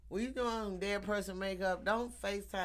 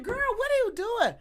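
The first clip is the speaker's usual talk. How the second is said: high, loud shocked squeal; 8 dB louder than usual. Retold or imitated. Teacher squealing at a friend's dead-body makeup. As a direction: shriek it like a friend just shocked you. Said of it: "I'm like".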